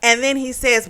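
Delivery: ordinary talk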